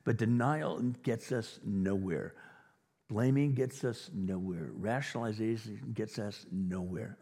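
each noun weighted and slowed; emphatic, slow. Real, not acted. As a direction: stress on denial, Blaming, Rationalization. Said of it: "emphatic triple parallel".

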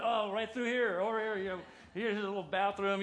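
high, loud mock urgency; frantic directing. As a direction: hurriedly usher someone out, hammed up. Frantically directing dad to bathroom.

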